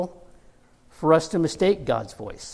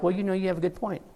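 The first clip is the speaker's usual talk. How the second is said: softer, fast, conceding voice; sheepish concession; 3 semitones higher, 5 dB quieter, faster than usual. Retold or imitated. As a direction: mumble it quickly like he gives in. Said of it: "And Balaam's like".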